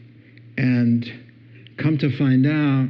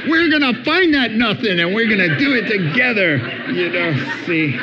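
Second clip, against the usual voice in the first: fast, high, laughing through the words; laughing while talking; 7 semitones higher, 3 dB louder, faster than usual. Real, not acted. Preacher laughing as he plays the eager husband. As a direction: giggle out the husband's eager promise.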